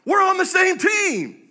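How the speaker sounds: high, loud, drawn-out yell; re-enacted indignant holler; exasperated shout. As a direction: shout it across at a stubborn protester. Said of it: "I just shouted at one of them".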